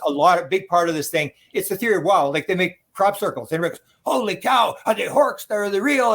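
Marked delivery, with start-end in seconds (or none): 4.0-6.1 mock amazement, pitched up; excited. Mimicking everybody reacting to crop circles, excited. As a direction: imitate a crowd marvelling. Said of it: "everybody's getting excited".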